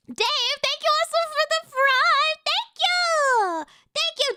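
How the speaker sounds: high, drawn-out shout of thanks; streamer's loud, high-pitched hype; excited thank-you. Real, not acted. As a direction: call out thanks in a high, stretched voice. Streamer hails a subscription.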